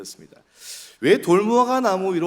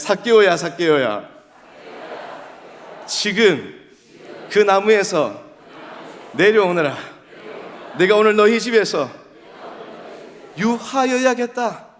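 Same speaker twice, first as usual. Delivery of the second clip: loud, raised call in short phrases; acting as Jesus; 7 semitones higher, 6 dB louder than usual. Shown as a demonstration. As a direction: proclaim it as Jesus for a crowd to repeat. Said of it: "한번 연기를 한번 해봅시다".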